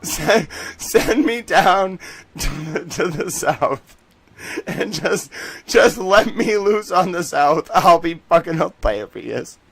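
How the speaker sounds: high, loud words squeezed through laughter; real laughing fit, slow and halting; helpless laughter. Real, not acted. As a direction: struggle to speak while cracking up. Laughing at his own Southern bit.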